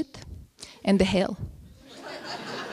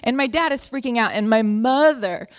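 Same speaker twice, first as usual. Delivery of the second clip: normal talking voice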